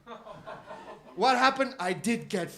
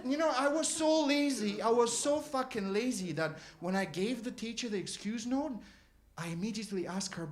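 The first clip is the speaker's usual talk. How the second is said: normal talking voice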